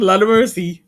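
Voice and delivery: loud Jamaican patois cry. Retold or imitated. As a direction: belt it out in patois. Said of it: "She said".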